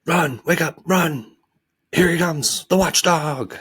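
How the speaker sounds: high, urgent alarm calls; panicked shouts. Acted. Lethargians warning each other as the watchdog comes, panicked. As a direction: shout everyone awake and flee.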